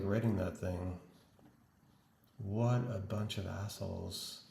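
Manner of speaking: usual speaking voice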